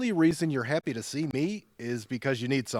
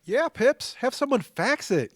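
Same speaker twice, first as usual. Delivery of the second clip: high, sing-song mock of his sister; high teasing parody of a fussy sister; 10 semitones higher than usual. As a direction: parrot her back in a higher, teasing voice. Brother mocking sister's suggestion.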